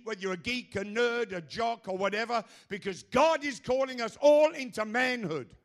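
loud, high, shouted sermon; full-force preaching. Acted. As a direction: preach it hard to the crowd.

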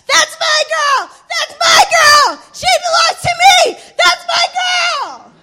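high, loud, drawn-out cheer; joyful yelling. Retold or imitated. Coach yelling in triumph as she pulls ahead. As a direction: scream it with pride. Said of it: "he's yelling his lungs out".

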